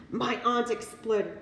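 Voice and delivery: ordinary delivery, nothing marked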